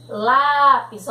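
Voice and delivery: "lápis" with a strong open á up front; open vowel. Shown as a demonstration. Teacher reading examples to pupils, "lápis" with forceful open á. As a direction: lean hard on the open á of "lápis". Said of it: "é um som aberto".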